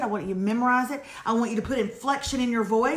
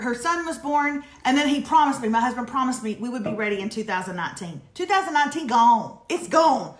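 ordinary talk, nothing marked

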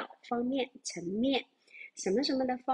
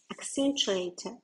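slow model word; slower than usual. Shown as a demonstration. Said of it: "最后看到了我们的".

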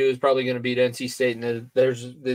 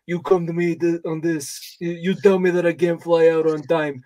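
put-on aggrieved New York–New Jersey accent, pitched up; Dan Hurley; 4 semitones higher than usual. Coach griping about travel arrangements, New York-Jersey accent. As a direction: put on a complaining Long Island coach's accent. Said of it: "that is literally a Dan Hurley accent".

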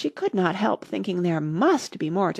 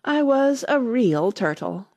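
slow, higher Mock Turtle lament; deep sigh; 5 semitones higher, 4 dB louder, slower than usual. A narrator's reading. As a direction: draw it out with a sigh. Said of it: "with a deep sigh".

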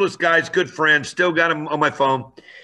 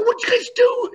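startled yell. Retold or imitated.

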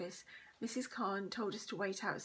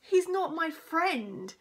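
loud, high, slow indignant denial; angry; 7 semitones higher, 8 dB louder, much slower than usual. A narrator's reading. Josie answers the teacher back, angrily. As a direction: protest crossly, stretching the words. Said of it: "said Josie angrily".

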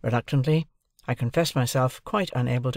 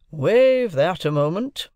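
slow, high, louder ruling; dismissive lawyer; 6 semitones higher, 6 dB louder, slower than usual. A narrator's reading. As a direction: set the question aside with authority. Lawyer brushes a question aside.

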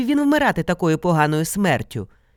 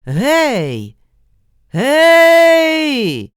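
loud answering shout, second one higher; calling back; 9 semitones higher, 8 dB louder than usual. A narrator's reading. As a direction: holler back into the forest. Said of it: "Микола озвався".

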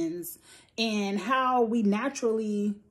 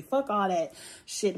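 usual speaking voice

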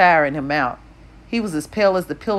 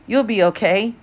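forced upbeat reassurance, a bit higher; fake cheerfulness; 5 semitones higher than usual. A narrator's reading. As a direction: pretend it's fine, masking worry. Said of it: "with a fake cheerfulness".

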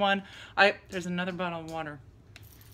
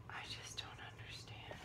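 softer, defeated whisper; 23 dB quieter than usual. Real, not acted. Mom giving up on the messy bedroom, whisper. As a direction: breathe it out in exhausted disbelief.

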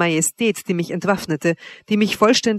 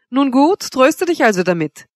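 higher, louder mocking dismissal; scornful; 6 semitones higher, 5 dB louder than usual. A narrator's reading. Wanda dismisses her jealous lover, scornfully. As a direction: toss it off with open scorn. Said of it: "sprach sie höhnisch".

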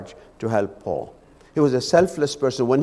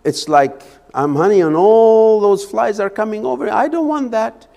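excuses voiced as Epaphroditus; 7 semitones higher, 5 dB louder than usual. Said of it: "He would have said".

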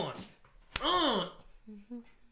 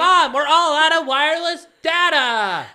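higher, loud call to mom; whiny kid calling mom; 9 semitones higher, 10 dB louder than usual. Pretend.

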